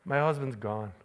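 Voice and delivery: soft, low mock complaint, laughing at the end; mock bewildered wife. Preacher retelling his mother-in-law's complaint about her changed husband, soft and amused. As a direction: say it as a puzzled wife, lightly. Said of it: "She goes".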